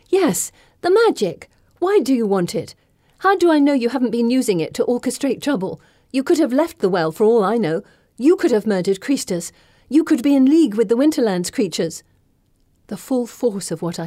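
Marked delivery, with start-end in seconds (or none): 0.1-12.0 rising, heated accusations; angry accusation. Acted. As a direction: hurl the suspicions at her. Accusing Circe, building anger.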